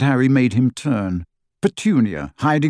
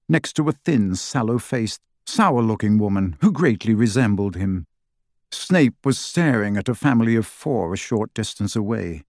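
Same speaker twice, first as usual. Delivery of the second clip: ordinary delivery, nothing marked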